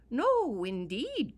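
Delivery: loud, high alarmed protest; alarmed cry. Acted. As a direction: blurt it out in alarm. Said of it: "cried old Pat, mighty alarmed".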